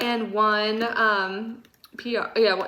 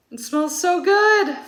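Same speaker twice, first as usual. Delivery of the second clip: delighted; 8 semitones higher, 5 dB louder, slower than usual. Real, not acted. First sniff of the candle.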